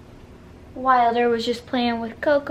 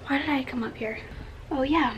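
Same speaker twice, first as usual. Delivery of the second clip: ordinary talk, nothing marked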